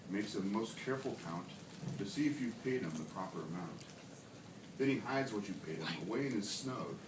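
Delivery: ordinary delivery, nothing marked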